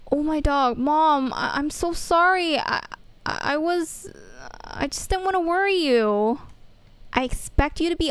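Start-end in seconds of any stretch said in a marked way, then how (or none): none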